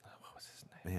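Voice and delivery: whispered to himself; whispering. Real, not acted. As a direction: mutter it under your breath. Trying to recall name.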